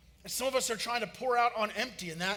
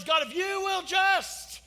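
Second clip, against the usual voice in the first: desperate prayer; 9 semitones higher, 6 dB louder, much slower than usual. Retold or imitated. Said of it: "it's that prayer".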